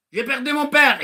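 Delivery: high plaintive lament; hammed-up grief; mock whining. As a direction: moan about it like a sulker. Mock complainer listing losses.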